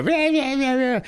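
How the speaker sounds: very high-pitched mock VTuber babble; cartoonish imitation of a screechy streamer; screaming VTuber impression. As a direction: squeal like an over-excited streamer, mockingly. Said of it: "and then they go".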